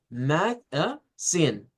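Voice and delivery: mag-a-zine in three slow separate pieces, force on "zine"; syllable-by-syllable stress demo. Shown as a demonstration. Teacher shows the first intonation, "mag-a-zine" split slowly, final stress. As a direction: break "mag-a-zine" apart, leaning on the last syllable. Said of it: "la primera entonación sería".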